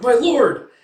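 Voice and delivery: loud, high alarmed call; acted cry out. Guard cries out to his collapsing master, loud and high. Servant crying out to collapsed lord.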